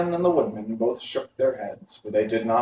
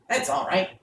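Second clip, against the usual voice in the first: higher bird voice; a sharp-eyed stork, pitched up; 8 semitones higher than usual. A narrator's reading. Stork correcting the travellers, higher voice. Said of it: "said the stork".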